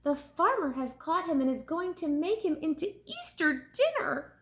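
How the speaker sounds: high, urgent calling for Mama; calling urgently. Acted.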